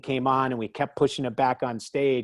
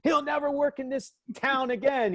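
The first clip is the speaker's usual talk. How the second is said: mock publicist; 13 semitones higher than usual. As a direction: imitate the furious publicist, pitched way up. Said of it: "screaming at me the whole time".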